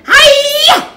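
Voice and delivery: very high, loud karate yell; battle cry. A narrator's reading. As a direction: shout it as she strikes. Red attacks the machines.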